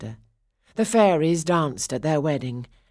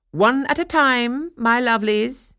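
wicked stepmother; 8 semitones higher, 8 dB louder than usual. Acted. Stepmother repeats her warning.

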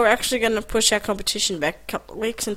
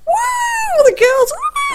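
mock cheering; 5 dB louder, much slower than usual. Imitating cheering girls.

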